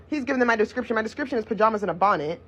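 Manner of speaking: normal talking voice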